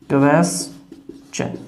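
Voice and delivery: क्वेश्चन said slowly, first syllable stressed: KWES-chən; slow careful model word. Shown as a demonstration. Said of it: "first syllable पे stress रह जाएगा". (क्वेश्चन धीरे, पहले syllable पर ज़ोर)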